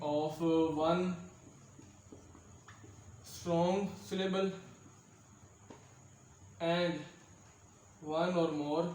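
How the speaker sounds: very slow, with long pauses between phrases; slow dictation. Real, not acted. Teacher writing out a definition for students, slow dictation. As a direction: dictate it piece by piece, slowly.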